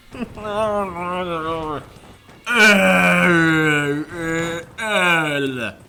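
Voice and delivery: loud, high shrieking laugh at the mic; a long wild cackle-scream. Real, not acted. Player screams and laughs into the microphone after a cheap death. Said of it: "I made a face at the microphone".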